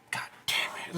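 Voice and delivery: hushed, whispered curse; low-key whispered exasperation, acted; muttered curse. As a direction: curse under your breath, almost voiceless. Acted quote of angry Kersey.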